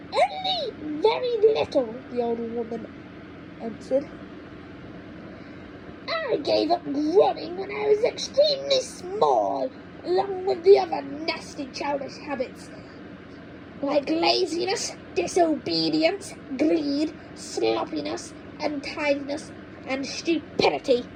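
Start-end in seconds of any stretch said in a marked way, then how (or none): 0.1-2.0 put-on old grandma voice. 6.3-21.1 put-on high, cranky old woman lecturing; cranky grandma. Grandma scolds George about growing, in a high cranky voice.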